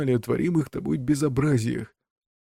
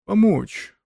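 put-on higher, astonished old ghost; 8 semitones higher, 4 dB louder than usual. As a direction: echo it in disbelief. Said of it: "повторил призрак в изумлении".

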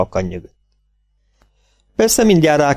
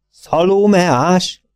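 puzzled echo; 8 semitones higher, much slower than usual. A narrator's reading. Vet echoes puzzled.

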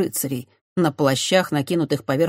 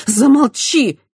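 sharp furious shout, much higher and louder; 9 semitones higher, 9 dB louder, slower than usual. A narrator's reading. Christiana shouting the priest down. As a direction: yell at him to stop. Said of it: "выкрикнула Христиана".

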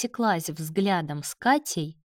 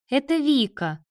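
eager introduction; 7 semitones higher, 4 dB louder than usual. A narrator's reading. Sister hurries to introduce friend.

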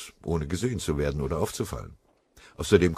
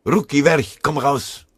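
lauter, hoher Befehlsruf aus der Deckung; brüll ihn aus dem Versteck heraus; brüllen; 9 semitones higher, 8 dB louder, slower than usual. Acted. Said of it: "Eine ihm bekannte Stimme brüllte". (laut gebrüllt, hoch und scharf)

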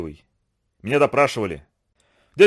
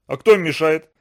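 usual speaking voice

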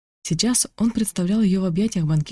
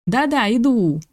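higher-pitched called reply; calling back; 6 semitones higher, 4 dB louder, slower than usual. A narrator's reading. Guest answering host's call from downstairs, calling back. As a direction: answer a call from another room.

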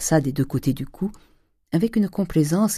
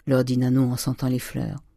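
ordinary delivery

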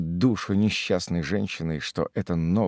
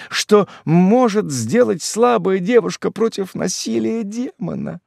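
high, raised plaintive lament; anguished widow; 10 semitones higher, 5 dB louder than usual. A narrator's reading. Widow pleads her innocence to Karl, high anguish. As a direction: plead your innocence to your love. Mother voiced by narrator.

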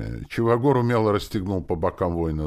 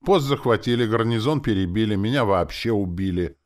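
louder, higher, emphatic listing; raised voice; 6 semitones higher, 6 dB louder than usual. A narrator's reading. Zombie chief berating doubting soldier, raised voice. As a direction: press each point on the listener.

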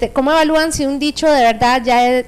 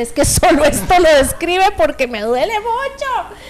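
mock real pain; 5 semitones higher than usual. Shown as a demonstration. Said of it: "a veces es la entonación".

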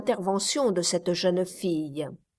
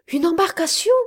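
high rising, louder echo; startled question; 9 semitones higher, 5 dB louder, faster than usual. A narrator's reading. Miss Campbell echoes the captain, startled and rising. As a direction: repeat it in disbelief. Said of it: "répondit Miss Campbell".

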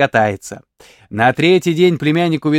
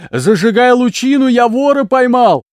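shouting; 7 semitones higher than usual. A narrator's reading. Uncle shouting to his wife that he caught a thief. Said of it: "закричал дядя на жену".

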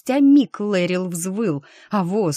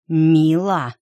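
hissing; 6 dB louder than usual. Acted. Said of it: "прошипел Лэрил".

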